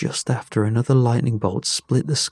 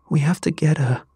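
higher-pitched urgent plea, mildly raised; shouted; 6 semitones higher than usual. A narrator's reading. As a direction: call it out in desperation. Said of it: "she shouted".

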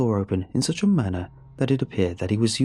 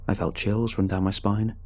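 ordinary delivery, nothing marked

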